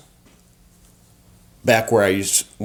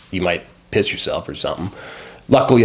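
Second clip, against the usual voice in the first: ordinary talk, nothing marked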